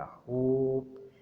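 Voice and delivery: होप with a long held O; lengthened vowel. Shown as a demonstration. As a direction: stretch the O in होप. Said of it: "हमने लंबा कर दिया".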